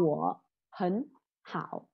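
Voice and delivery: "Của, hấn, hảo" slow, "hấn" rising as second tone; middle-word sandhi. Shown as a demonstration. Teacher modelling three third tones, "hấn" changed to rising tone. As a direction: separate each word, lift "hấn" in the middle. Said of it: "sẽ biến cái từ thứ hai thành".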